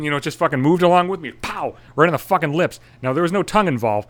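usual speaking voice

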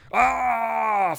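a sharp, high angry yell; mock rage roar. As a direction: bellow like the furious dad. Dad rages, throws chair.

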